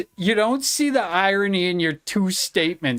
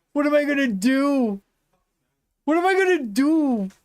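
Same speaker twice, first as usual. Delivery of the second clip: high, hammed-up fake worry; mock panic; 6 semitones higher than usual. Streamer mocking Rob, fake frightened whine. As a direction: whine it like you're doomed.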